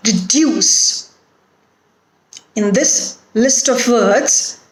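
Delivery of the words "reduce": weak re, strong duce in reduce; stress on second syllable. Shown as a demonstration. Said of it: "put the stress on the root word".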